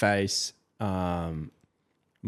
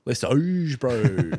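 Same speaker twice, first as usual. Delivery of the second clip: hyped eshay voice saying Oge; aussie eshay slang; 4 semitones higher, 4 dB louder, much slower than usual. Pretend. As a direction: shout it like an eshay lad. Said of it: "eshay slang, named by earlier reader".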